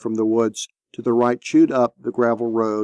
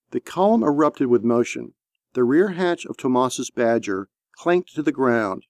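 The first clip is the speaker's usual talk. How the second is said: ordinary delivery, nothing marked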